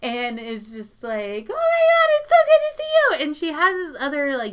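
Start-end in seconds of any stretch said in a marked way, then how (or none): 1.5-3.2 very high, loud, fast mock gush; gushing woman. Cougar hugging Grandpa, very high gushing greeting. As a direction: overdo the fake delighted greeting.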